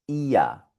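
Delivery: ia said as German ihr, R vocalized. Shown as a demonstration. Teacher demonstrating German ihr. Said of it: "que suena exactamente igual".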